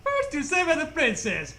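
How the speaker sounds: normal talking voice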